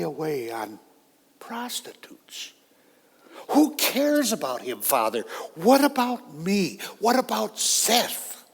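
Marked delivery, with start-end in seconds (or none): 1.4-2.5 slow whisper of contempt; disgusted whisper. Acted. Spitting out the brother's shame. 3.5-8.4 shouting at father. Acted. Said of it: "I shouted at my father".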